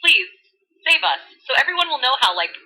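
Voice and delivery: put-on bubbly valley girl voice; valley girl retelling scripture. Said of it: "in full Valley Girl mode".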